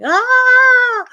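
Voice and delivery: high, loud scream coming out of the water; sudden high yelp echoing in a pool; retold scream. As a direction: let out a piercing shriek of shock. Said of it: "I came up screaming".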